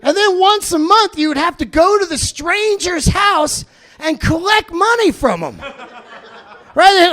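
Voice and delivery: ordinary talk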